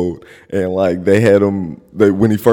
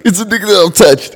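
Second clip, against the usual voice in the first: laughing; 10 semitones higher, 5 dB louder, faster than usual. Real, not acted. Telling a co-host about a guy he knows, laughing high. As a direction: laugh it out much higher in disbelief.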